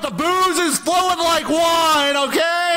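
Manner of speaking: high, slow sing-song mockery; mock her in a high silly voice. Mocking the drunk girlfriend.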